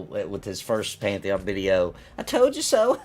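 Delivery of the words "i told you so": high-pitched taunt breaking into laughter; mocking gloating laugh. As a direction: imitate someone cackling at others' misfortune. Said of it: "His laughing and thinking it's so freaking funny".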